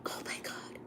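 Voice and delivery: breathless whisper; whispered oh my God. Real, not acted. Vlogger whispering to chat in horror. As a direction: breathe it out, horrified.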